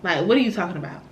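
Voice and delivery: ordinary delivery, nothing marked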